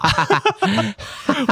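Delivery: a high-pitched laugh of disbelief. Real, not acted. Laughing at the story.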